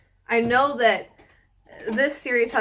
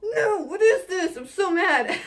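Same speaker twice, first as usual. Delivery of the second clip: shocked outrage; 9 semitones higher, slower than usual. Retold or imitated. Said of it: "I was like".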